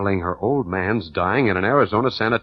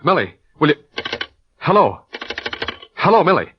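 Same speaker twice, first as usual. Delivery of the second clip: raised, high calls into the phone; urgent calling; 6 semitones higher, 4 dB louder than usual. Acted. Man trying to get her back on the phone, urgent. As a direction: call out louder as the line goes dead.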